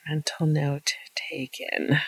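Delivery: slow hushed aside; whispered. Real, not acted. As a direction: say it under your breath, slowly. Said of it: "repeat whispered".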